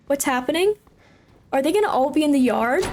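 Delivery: ordinary talk, nothing marked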